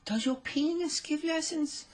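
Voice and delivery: put-on high, slow, innocent girlish question; teen girl voice. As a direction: ask it sweetly like a teen fan. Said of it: "she said".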